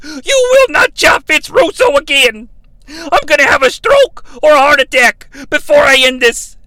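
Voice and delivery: loud, high shouted challenge; furious callout. Retold or imitated. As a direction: scream the challenge at Flair. Russo calls out Flair.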